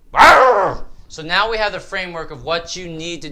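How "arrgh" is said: high, loud playful growl; sudden loud growling roar. Real, not acted. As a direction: let out a big rolling "arrgh". Playful outburst between sections.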